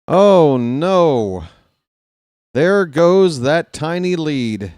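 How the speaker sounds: loud groaned dismay, falling away; heavy, drawn-out fan's dismay; dismayed lament. Real, not acted. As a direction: mourn the lost lead aloud. Laments the lost lead.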